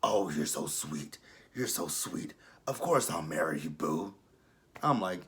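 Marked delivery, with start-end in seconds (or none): 0.0-4.1 low, soft, cooing mock; sweet Angela voice. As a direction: coo it like a smitten woman. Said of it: "And she says".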